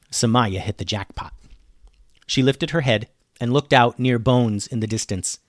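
plain reading voice

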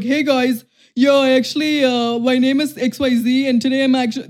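mocking imitation of his social-media voice; mock put-on voice. Woman mimicking her ex's social media intro, mocking put-on voice. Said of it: "this is how he's talking".

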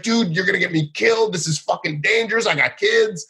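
high, upset complaint; pissed off. Retold or imitated. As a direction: vent it like you nearly got killed. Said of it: "Rel was pissed".

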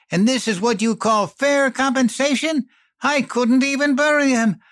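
high, loud outburst of grief and rage; a widow tearing into the company man; furious hiss. A narrator's reading. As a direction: spit the fury at him, voice way up. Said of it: "and hissed".

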